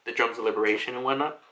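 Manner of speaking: ordinary delivery, nothing marked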